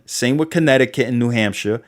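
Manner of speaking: ordinary talk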